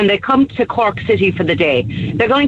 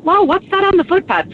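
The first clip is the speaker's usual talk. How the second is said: surprised exclamation; 10 semitones higher than usual. Retold or imitated. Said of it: "I went".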